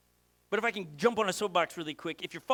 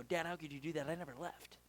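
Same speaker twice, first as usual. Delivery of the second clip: much softer, hurt grumbling complaint; resentful older son; 3 semitones lower, 11 dB quieter than usual. Retold or imitated. Older brother complaining to his father, soft and hurt. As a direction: mutter it like a wounded, jealous brother. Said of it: "and go".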